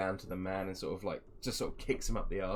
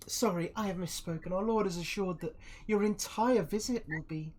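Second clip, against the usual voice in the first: high, formal put-on voice; apologetic villainous envoy; 10 semitones higher than usual. Envoy amends the lord's promise to the guest, high and courtly. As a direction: smoothly correct yourself in a high voice.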